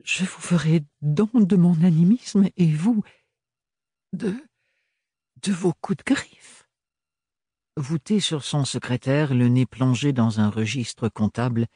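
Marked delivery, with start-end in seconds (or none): 0.0-6.7 voiced higher, edged young woman's reproach; Ophélie, tense young woman. A narrator's reading. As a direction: lift the voice for a proud, hurt young woman. Ophélie's voice in the novel. (jeune femme blessée et fière, voix plus aiguë)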